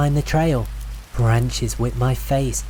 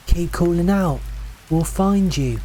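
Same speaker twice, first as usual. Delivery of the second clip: high, encouraging call across distance; shouting back; 7 semitones higher than usual. A narrator's reading. Hiker to lost stranger in fog, shouting back. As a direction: call out to someone far off, restrained volume. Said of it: "I shouted back".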